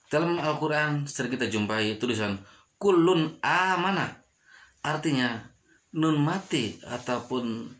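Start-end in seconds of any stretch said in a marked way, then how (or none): 2.8-4.2 "kulun amana" with a crisp unhummed n; slow, clearly cut n in "kulun amana"; clear idhar reading. Shown as a demonstration. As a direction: recite "kulun amana", clean n straight into the a. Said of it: "dibaca udah jelas".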